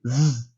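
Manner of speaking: soft sustained "z" buzz; voiced "z" held alone; demonstrated buzzing "z"; isolated z sound. Shown as a demonstration. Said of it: "nesse aqui".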